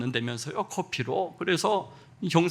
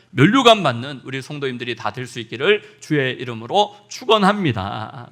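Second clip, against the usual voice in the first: preaching blessing; 4 semitones lower, 8 dB louder than usual. Real, not acted. Pastor blessing the congregation, loud solemn proclamation. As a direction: proclaim the blessing over the flock.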